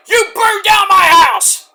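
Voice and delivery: high, loud, slow mock accusation; imitated wrestler's furious yell; Swerve yelling. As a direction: drag out an outraged shout. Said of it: "Page and Swerve are yelling at each other".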